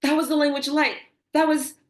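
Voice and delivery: high, loud, awestruck outburst; re-enacted giddy astonishment; excited. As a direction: burst out in amazed excitement. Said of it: "And I was so excited".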